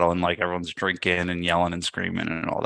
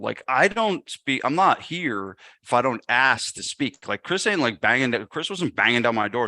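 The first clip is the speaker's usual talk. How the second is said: ordinary delivery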